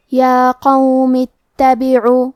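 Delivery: یا قوم اتبعو slow, linked straight to ta; hamzat wasl skipped. Shown as a demonstration. Teacher shows linking over hamzat wasl, slow recitation. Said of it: "حرف التا کو پڑھیں گے".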